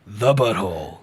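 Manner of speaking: drops way low and booms it; low mock announcer echo; deep voice.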